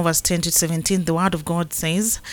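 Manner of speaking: ordinary talk, nothing marked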